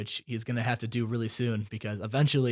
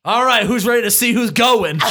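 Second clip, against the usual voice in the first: loud, higher pumped-up host voice; louder, higher mock host hyping the vote; hyped host; 9 semitones higher, 11 dB louder than usual. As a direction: shout it like a hyped game-show host. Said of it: "he'd be just like".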